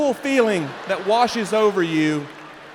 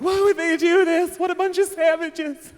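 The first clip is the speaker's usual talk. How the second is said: high, slow, shocked; scandalized church team; 10 semitones higher, slower than usual. Retold or imitated. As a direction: clutch your pearls in horror at them. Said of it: "they were like".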